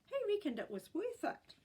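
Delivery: beam with pride as great gran; proud great gran. A narrator's reading. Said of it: "beamed proudly".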